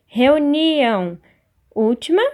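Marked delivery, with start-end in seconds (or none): 0.1-1.2 Reunião slow and loud, NI stretched; stress on penultimate syllable. Shown as a demonstration. Teacher helping pupils locate the tonic, slow stress on NI in Reunião. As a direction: hold and punch the NI in Reunião. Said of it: "penúltima".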